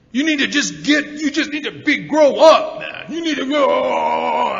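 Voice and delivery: high, loud, slow mock-drunk grandpa bellow; drunk grandpa scolding. Grandpa yells at grandson to grow up, hammed-up drunk bellow. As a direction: boom it out like a tipsy old man laying down the law.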